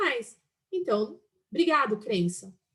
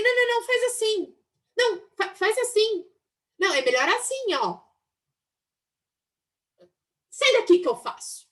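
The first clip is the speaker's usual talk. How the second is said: mock bossy nagging; 8 semitones higher than usual. Mocking a controlling attitude.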